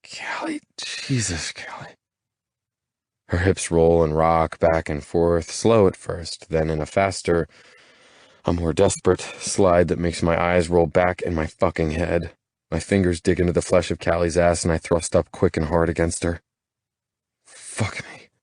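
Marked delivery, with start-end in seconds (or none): 0.0-1.9 groaned name. Acted. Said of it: "I groan back, low in my throat". 17.4-18.3 breathless whisper. Acted. Lovers kissing, aroused curse.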